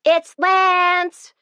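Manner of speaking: high excited shriek. A narrator's reading. Sarah announcing the caller to her sister, shrieking. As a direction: shriek the news out. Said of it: "she shrieked".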